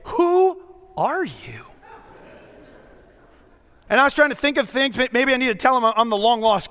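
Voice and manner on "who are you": slow, drawn-out puzzled host. Retold or imitated. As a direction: stretch it out like a baffled host. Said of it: "they look at me and say".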